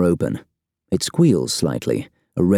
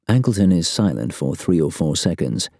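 usual speaking voice

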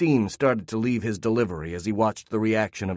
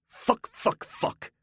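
angry father cursing his losses; repeated curse; 14 semitones higher, 4 dB quieter, much slower than usual. Acted. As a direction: swear at the screen over and over. Father swears at lost bets.

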